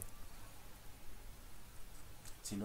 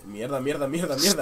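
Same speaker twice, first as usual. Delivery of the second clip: cursing louder and higher; panicked cursing; 4 semitones higher, 12 dB louder than usual. Real, not acted. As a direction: swear in rising panic. Under fire in game.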